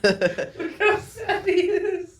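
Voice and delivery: high, slow, laughing mock-sad voice; teasing mock sympathy, drawn out high; mock pity. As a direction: coo it like pitying a sad puppy, laughing. Teasing a friend who got shot.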